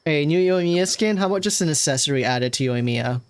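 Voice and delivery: ordinary talk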